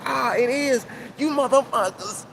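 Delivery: imitating a scornful voice; mock accusing. Imitating listeners catching the lie.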